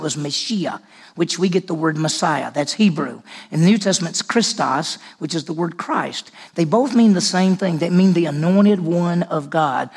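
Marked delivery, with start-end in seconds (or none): none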